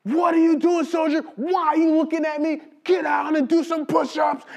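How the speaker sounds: high-pitched mock drill-sergeant yell. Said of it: "they're yelling before we got out".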